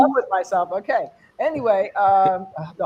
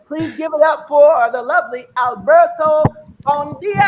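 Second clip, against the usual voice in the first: stage announcer intro; 4 semitones higher, 6 dB louder than usual. Real, not acted. MC bringing the next comic on, stage announcer intro. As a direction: belt out the comic's name, stretching the end.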